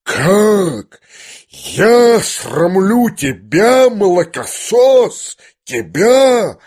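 high, slow, wounded fury; outraged cry. A narrator's reading. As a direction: wail each word in insulted disbelief. General outraged by his son's reproach. (оскорблённо, надрывно, с протяжными выкриками)

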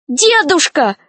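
high-pitched call to grandpa; alarmed cry. A narrator's reading. Snegurochka calls to Grandfather Frost, alarmed high cry. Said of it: "закричала Снегурочка".

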